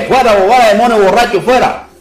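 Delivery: high, loud mocking cry of fuera fuera; mock exorcism shout. Preacher mocking an overeager exorcist, loud high shout. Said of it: "usted echa el demonio borracho enseguida".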